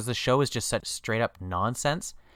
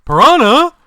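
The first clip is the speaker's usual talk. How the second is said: shrill, hammed-up shocked actor; overacted shriek of surprise; bad acting; 15 dB louder, slower than usual. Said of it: "try to deliver the line like he does".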